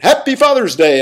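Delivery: high, loud sing-song holiday greeting; cheery greeting. Retold or imitated. Friend calling on Father's Day with a high, loud cheery wish. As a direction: chirp it out like a friend phoning in cheer. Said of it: "and went".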